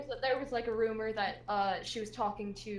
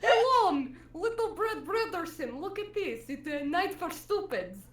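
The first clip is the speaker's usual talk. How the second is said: put-on Russian accent; 8 semitones higher, 11 dB louder than usual. Teacher mocking student.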